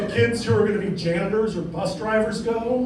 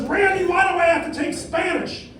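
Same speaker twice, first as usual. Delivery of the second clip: high, shouting complaint; whiny Carl; 10 semitones higher than usual. Acted. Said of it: "But he says".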